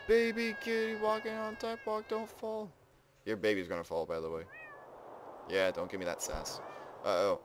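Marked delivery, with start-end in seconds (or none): 0.1-2.7 gasp, then coo high, softening at the end; cutesy cooing. Real, not acted. Player watching a baby kitty walk, high cutesy cooing. Coos over a baby kitten.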